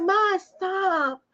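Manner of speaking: embarrassed daughter's protest. Retold or imitated. Daughter to mom wanting her hand, embarrassed protest. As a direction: imitate an embarrassed kid pleading.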